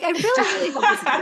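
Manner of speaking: high, rushed, laughing gush; eager pleading. Acted. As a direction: gush it fast and high, giggling. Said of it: "But I'm like".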